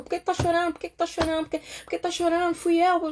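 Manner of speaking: high, repeated worried questioning; mock anxious pestering. Onlooker pressing a crying person for reasons, mock anxious.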